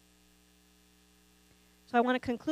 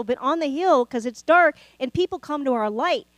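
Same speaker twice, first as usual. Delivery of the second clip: louder, higher preaching that keeps climbing; full-force preaching; 3 semitones higher, 7 dB louder than usual. Acted. Pastor urging the church toward friendship, preaching louder and higher. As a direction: drive it home to the congregation.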